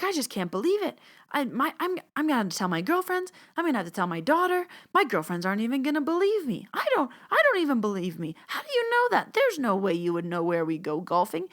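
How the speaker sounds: high-pitched, rapid, gushing disbelief; hammed-up thrilled student; excited disbelief. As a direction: imitate her giddy can't-believe-it rush. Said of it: "she was getting all excited".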